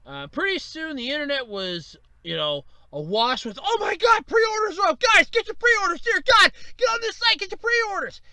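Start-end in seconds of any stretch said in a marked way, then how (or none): 3.6-8.2 high, loud panicked urging; frantic online crowd. Acted. Internet users alerting each other to pre-orders, frantic shouting. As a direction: shout it in a high-pitched frenzy.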